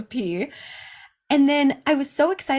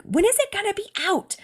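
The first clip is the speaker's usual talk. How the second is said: high, worried plea; fretful question waiting for the needle; anxious patient; 13 semitones higher than usual. Retold or imitated. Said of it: "I remember going".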